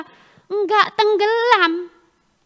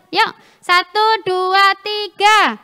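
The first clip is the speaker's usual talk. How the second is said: loud, higher, stretched-out count; rally the group to join in loudly; rousing count-in; 3 semitones higher, 6 dB louder, much slower than usual. Real, not acted. Preacher urges children to recite louder, rousing count-in.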